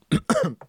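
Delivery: sudden sharp cough; choking cough. Real, not acted. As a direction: cough hard on swallowed saliva. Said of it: "J'ai avalé ma propre salive de travers".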